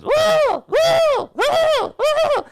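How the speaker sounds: very high squealing cartoon woo; shrieking cartoon panic, way up high; loud high-pitched cartoon shriek; SpongeBob woo. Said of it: "SpongeBob just goes".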